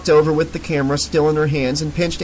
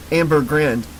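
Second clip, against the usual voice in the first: plain reading voice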